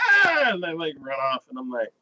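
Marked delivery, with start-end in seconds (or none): 0.1-0.5 high, loud mock shriek; hammed-up frightened cry; crowd scream. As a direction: scream and flee in pretend terror. Townsfolk scream, acted.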